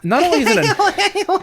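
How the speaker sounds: high laughing squeal; cracking up mid-sentence. Real, not acted. Laughing at ghost idea.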